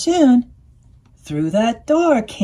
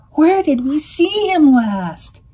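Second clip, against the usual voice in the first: wailing; 5 semitones higher, 5 dB louder than usual. A narrator's reading. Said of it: "the squirrel wailed".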